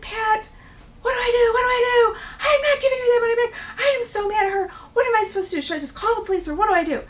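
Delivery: high, loud, wailing panic; re-enacted panic. Frightened landlord calling husband for advice, panicking. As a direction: blurt it frantically down the phone, easing slightly by the end. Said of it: "I'm all sweating and in a panic".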